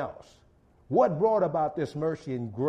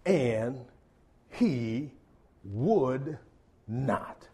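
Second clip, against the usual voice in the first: each word dropped alone, much softer and lower; slow dramatic pauses; 7 semitones lower, 5 dB quieter, much slower than usual. Acted. Preacher slowly spells out the servant's refusal, hushed. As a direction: let every word hang in a long pause.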